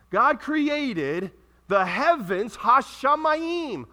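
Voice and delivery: loud, drawn-out teaching delivery; slow and clear. Shown as a demonstration. Preacher teaches the congregation the Hebrew for heavens, slowly and clearly. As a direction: stretch each word out for clarity. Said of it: "say it with clarity and slowly".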